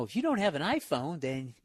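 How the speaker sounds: lightly voiced smug commercial; a hint of a condescending announcer. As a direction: read it like a smug ad. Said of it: "that really cunty commercial where they go".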